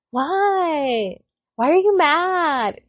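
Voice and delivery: high, drawn-out challenge; mock bratty child. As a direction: imitate a kid talking back. Imitates son talking back.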